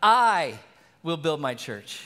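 I, then a pause, then WILL; WILL said firm, building to church; stress on WILL. Shown as a demonstration. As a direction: hold after I, then press on will. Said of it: "I'm reminded by God".

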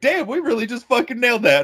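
high-pitched, thrilled crowd voice; hyped crowd imitation. Retelling the crowd nailing the lyric, high excited voice. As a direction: imitate fans amazed at themselves. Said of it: "They were like".

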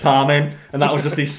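ordinary delivery

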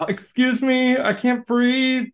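mock complaint. Buried actress protesting to the crew about her straw, mock complaint.

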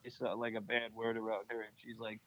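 ordinary talk

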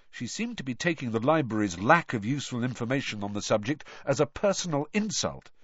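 ordinary delivery

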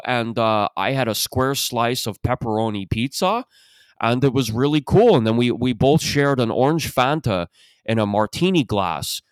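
happy Toronto guy with swooping vowels; Toronto accent. Acted. Toronto husband recounting his anniversary dinner, mock Toronto accent. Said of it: "That's how they talk".

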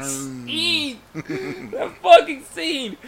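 very high, drawn-out squeal; high-pitched stretched exclamation; exasperated amusement. Real, not acted. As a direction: wail it slowly in comic disbelief. Exclaiming again over the ridiculous scene.

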